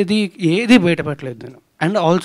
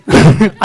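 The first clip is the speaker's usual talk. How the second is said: short, loud laugh; amused laugh; 5 dB louder than usual. Real, not acted. Film star amused by a reporter's question, real laugh.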